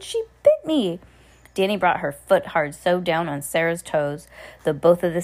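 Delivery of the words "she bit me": put-on high, complaining girl's voice; indignant shout. A narrator's reading. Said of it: "she shouted".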